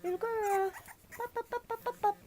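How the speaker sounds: high coaxing chant; puppy talk. Real, not acted. Owner coaxing puppy upward, high chant.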